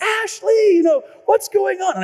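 high, loud panicked call for Ashley. Retold or imitated. Husband calling his wife in panic over the flooded basement.

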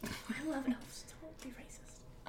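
breathy slow whisper. Real, not acted. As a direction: whisper it slowly behind a hand. Teasing aside at the table.

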